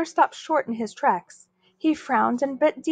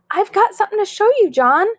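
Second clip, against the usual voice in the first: high, loud and quick; excited shout; 10 semitones higher, 5 dB louder than usual. Acted. Susan calling to John from the jungle gym, excited shout. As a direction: call out eagerly across the playground. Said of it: "she shouted".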